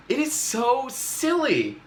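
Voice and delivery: whiny complaint; exasperated whine. Pretend. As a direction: draw it out in mock exasperation. Reader complaining.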